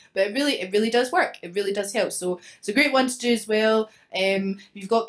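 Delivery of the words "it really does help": usual speaking voice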